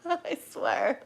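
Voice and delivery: a small tearful break in the voice; crying. Real, not acted. Reader admitting to live viewers she is crying, tearful. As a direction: let the tears catch the words. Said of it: "She's crying".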